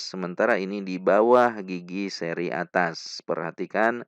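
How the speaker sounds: ordinary delivery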